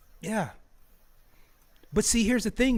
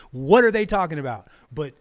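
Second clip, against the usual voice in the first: ordinary talk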